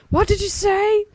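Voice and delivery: high, loud shout; yelled. A narrator's reading. Deaf old cashier yelling at Dipper. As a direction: yell it like a deaf old man. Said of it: "the cashier yelled".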